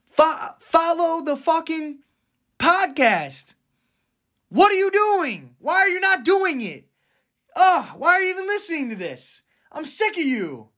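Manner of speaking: high, exasperated whiny rant; fed-up squeaky outburst; annoyed ugh. Acted. Ranting at listeners who won't follow.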